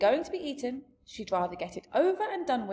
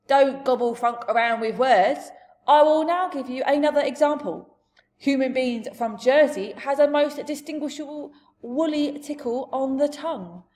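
loud, raised giant voice; big giant voice, higher and louder; expounding giant; 5 semitones higher, 9 dB louder than usual. Acted. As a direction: hold forth as a blustering giant. Giant explains human flavours.

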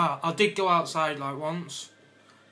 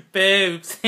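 laughing; 4 semitones higher, 11 dB louder than usual. Real, not acted. Repeats word laughing.

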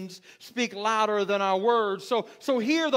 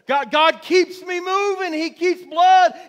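loud, raised-pitch pulpit exhortation; full-force preaching; 6 semitones higher, 7 dB louder than usual. Real, not acted. Preacher asking who is excited about God today, full-force preaching. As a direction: drive it at the congregation, building in volume.

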